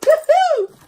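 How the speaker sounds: very high, loud cheer of joy; excited woohoo. Real, not acted. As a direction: burst out cheering with real excitement. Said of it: "Oh, I'm so excited".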